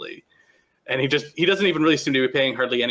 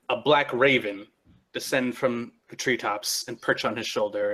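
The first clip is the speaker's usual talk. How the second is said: usual speaking voice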